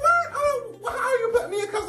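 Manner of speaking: high, shocked squeal, half laughing; mock shock. To the cop cuffing her, high mock shock. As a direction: act stunned at the cuffs. Being cuffed, acting shocked.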